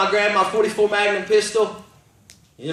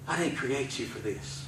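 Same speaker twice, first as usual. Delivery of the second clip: low, very soft; hushed divine voice; 3 semitones lower, 12 dB quieter than usual. Retold or imitated. God to a man about to end it, hushed. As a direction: speak it low and softly, with weight.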